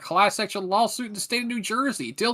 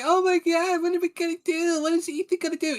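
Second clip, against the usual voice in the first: higher whiny mock panic voice; mocking panicked fan; 7 semitones higher than usual. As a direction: imitate a fretting fan, pitched up. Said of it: "it's like".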